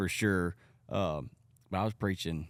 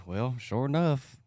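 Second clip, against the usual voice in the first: ordinary talk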